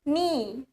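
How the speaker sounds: knee said as "nee", K left silent. Shown as a demonstration. Teacher showing learners how knee is read, K silent. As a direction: start knee straight on the n sound. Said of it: "Tapi K-nya nggak dibaca".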